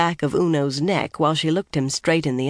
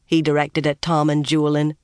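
ordinary delivery, nothing marked

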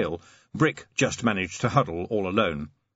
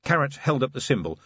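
plain reading voice

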